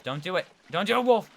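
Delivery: loud high yell at Wolf. Real, not acted. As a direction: shout the warning across the room. Yelling at Wolf in game.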